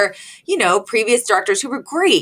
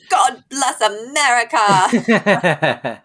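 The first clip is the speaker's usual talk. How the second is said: mock proud; 3 semitones higher, much slower than usual. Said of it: "And then Maxwell goes".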